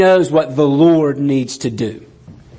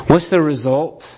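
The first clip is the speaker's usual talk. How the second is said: ordinary talk, nothing marked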